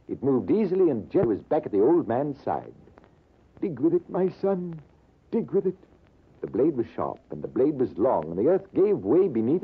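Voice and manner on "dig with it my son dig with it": put-on old Persian urging. Old Persian voiced in story.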